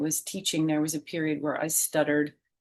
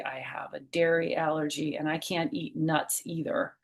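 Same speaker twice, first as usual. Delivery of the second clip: usual speaking voice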